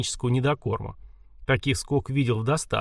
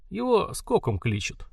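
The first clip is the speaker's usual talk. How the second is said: grumbled; 8 semitones higher than usual. A narrator's reading. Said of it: "недовольно пробурчал".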